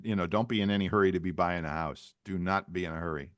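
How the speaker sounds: ordinary talk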